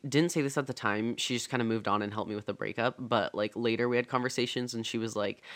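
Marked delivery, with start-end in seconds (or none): none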